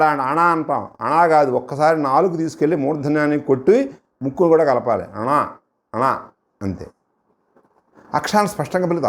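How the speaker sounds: normal talking voice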